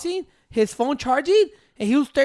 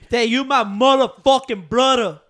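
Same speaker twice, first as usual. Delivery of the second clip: slow, put-on Memphis accent; a hint of [name]'s Memphis accent; 3 dB louder, slower than usual.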